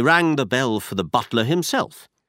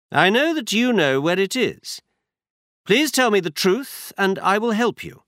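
brisk, higher-pitched detective voice; Holmes voiced higher; 10 semitones higher than usual. A narrator's reading. Holmes tells Watson where they go, higher detective voice. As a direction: voice Holmes up high, assured. Holmes presses Lady Hilda.

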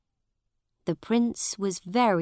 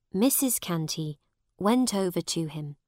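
usual speaking voice

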